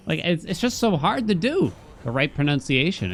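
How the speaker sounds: normal talking voice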